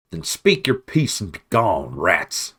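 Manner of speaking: loud, harsh dismissal; harsh and unafraid. Acted. Badger Constance sending the rats away, harsh and loud. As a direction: spit it at them, unafraid. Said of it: "reply was harsh and unafraid".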